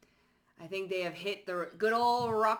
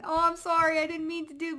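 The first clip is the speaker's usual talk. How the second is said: high, louder mock sorry voice; fake apology; 9 semitones higher, 6 dB louder than usual. Mocking someone who fakes an apology, high whiny voice. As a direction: ham up an insincere sorry. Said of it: "but they ain't sorry".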